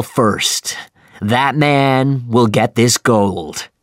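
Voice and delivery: loud, slow, booming captain's voice; rally the crew like a grim captain; commanding captain. A narrator's reading. Ahab addresses the crew.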